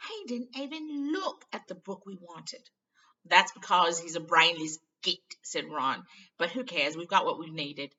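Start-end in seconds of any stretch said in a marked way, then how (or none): 0.1-2.7 higher, slowish complaint; indignant Ron. Acted. 3.2-5.4 loud, slow contempt; scornful Ron. Acted. Ron insulting. 6.4-7.9 louder, dismissive; offhand Ron. Acted.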